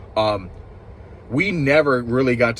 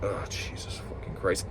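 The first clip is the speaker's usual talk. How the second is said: exasperated mutter; 3 semitones lower, 10 dB quieter than usual. Real, not acted. Reviewer fed up with the show.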